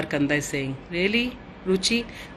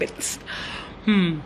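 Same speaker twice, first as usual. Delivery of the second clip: normal talking voice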